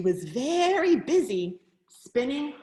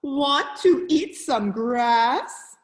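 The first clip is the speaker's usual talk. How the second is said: slow, louder cow voice; 6 dB louder, slower than usual. A narrator's reading. Cow inviting the spider to graze, slow and loud. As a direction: draw the question out loudly as a cow. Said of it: "said the cow".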